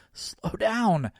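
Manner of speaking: breathless plea, out of breath. Acted. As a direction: gasp it out while out of breath. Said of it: "I strain to catch my breath".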